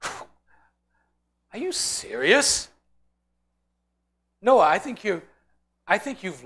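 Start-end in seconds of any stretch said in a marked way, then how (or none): 0.0-2.7 slow, drawn-out disbelieving question, pitched up; incredulous disbelief. Acted. Bystander scoffing at Noah, slow incredulous question. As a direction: stretch it out like you can't believe him.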